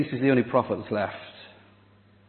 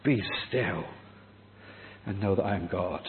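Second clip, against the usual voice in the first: quiet scripture quote; 5 semitones lower, 8 dB quieter than usual. Retold or imitated. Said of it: "Resonates with Psalm forty-six".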